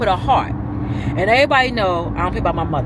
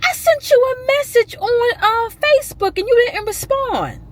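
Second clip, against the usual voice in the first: imitating aunt; 3 dB louder than usual. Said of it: "She was like".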